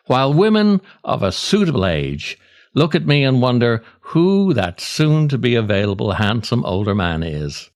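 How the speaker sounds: ordinary talk, nothing marked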